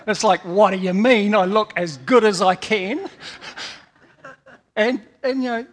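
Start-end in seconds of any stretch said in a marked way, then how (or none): 0.4-3.1 louder indignant retort, mildly played; a hint of an insulted wife; mock offended wife. Wife offended by backhanded compliment.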